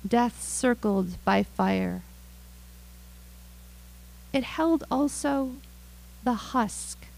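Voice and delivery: measured poem reading with long pauses; slow poem reading. Real, not acted. Poet reading a little poem about peace, slowly. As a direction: read each short line slowly, letting it settle. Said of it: "I'll try to read it slowly".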